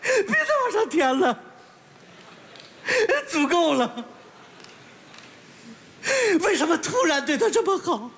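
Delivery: high, choked-up sobbing plea; tearful crying. Acted. Moved listener begging Dad to stop adding money, tearful. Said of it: "你别哭了".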